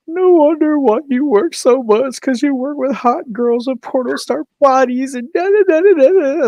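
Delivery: high, loud, whiny imitation of the girlfriend; mock her jealous complaint in a high voice; whiny mock rant. Teller mocks his crying girlfriend's jealous rant, high and whiny.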